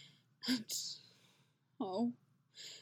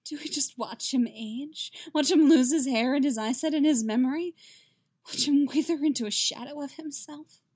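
rising anguish; 6 semitones higher, 6 dB louder than usual. A narrator's reading. Yennefer pleading in rising grief.

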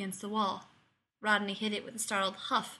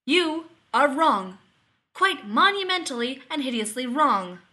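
declaring loudly; 4 semitones higher, 9 dB louder than usual. A narrator's reading. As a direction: lay down the verdict. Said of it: "Rodney declared".

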